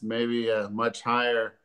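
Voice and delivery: ordinary talk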